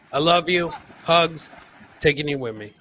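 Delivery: ordinary talk, nothing marked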